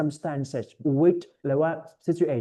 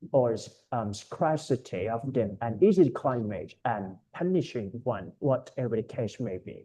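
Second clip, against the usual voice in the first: slow, deliberate read of the passage; careful reading aloud; 3 semitones lower, 4 dB quieter, much slower than usual. Shown as a demonstration. Tutor reads the passage to his student, slow and careful. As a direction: read each phrase slowly so the student hears the accent. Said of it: "ผมจะอ่านให้ฟังนะ เพื่อดึงสำเนียงนะครับ".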